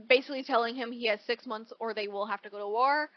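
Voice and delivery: ordinary talk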